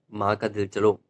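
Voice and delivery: ordinary talk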